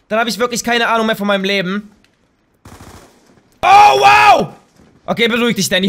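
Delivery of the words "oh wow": high, loud excited scream; real shout. Real, not acted. Gamer screaming at a stunning kill, loud. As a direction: burst out screaming at the play. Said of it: "Hör auf zu schreien".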